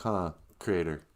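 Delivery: usual speaking voice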